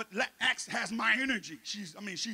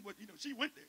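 ordinary talk, nothing marked

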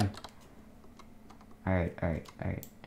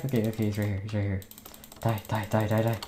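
ordinary delivery

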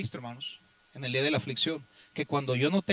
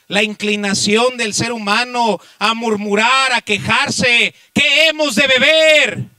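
loud, raised pulpit voice; impassioned preacher's shout; full-force preaching; 7 semitones higher, 13 dB louder than usual. Real, not acted. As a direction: drive each phrase out to the congregation with force.

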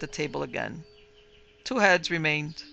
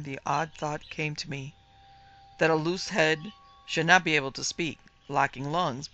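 ordinary delivery, nothing marked

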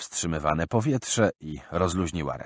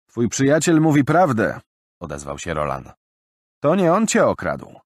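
higher, louder confident statement; calm sure young man; 7 semitones higher, 7 dB louder than usual. Acted. Roland telling Bill his friend is honest, confident. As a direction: settle the quarrel with certainty.

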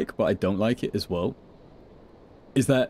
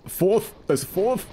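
high startled cry; shocked, voice jumping up; surprised; 11 semitones higher, slower than usual. Real, not acted. Another enemy appears.

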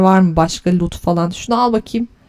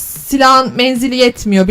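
ordinary delivery

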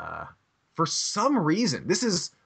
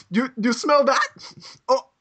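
put-on drunk German tourist; 11 semitones higher, 4 dB louder, slower than usual. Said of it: "the guy's like".

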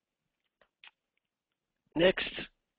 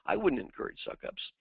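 smug self-assured coach; 10 semitones higher than usual. Retold or imitated. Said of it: "You're probably thinking".

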